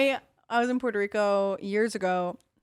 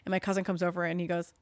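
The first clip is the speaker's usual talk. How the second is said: ordinary talk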